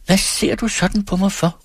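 high, upset demand; indignant cry. A narrator's reading. Lilian cries out at Hans, high and indignant. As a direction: fling the question at him, offended. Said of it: "råbte hun oprørt".

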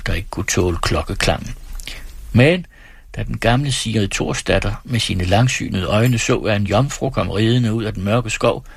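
plain reading voice

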